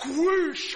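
loud, high whoosh of exploding water; imitated splash whoosh. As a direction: let the splash burst out.

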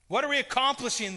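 high, loud frustrated outburst; exasperated council elder. Acted. Council elder venting at the others, loud and frustrated. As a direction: pound the table and demand answers. Sanhedrin council arguing heatedly.

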